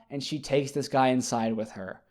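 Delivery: ordinary talk, nothing marked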